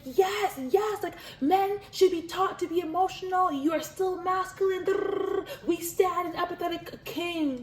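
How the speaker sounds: cheering on a man for showing emotion; excited tweet voice. Retold or imitated. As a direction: read your own tweet like a hype fan. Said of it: "That's what I tweeted".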